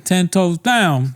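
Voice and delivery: high, drawn-out slangy catchphrase; mock slang talk.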